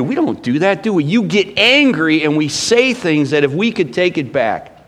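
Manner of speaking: ordinary talk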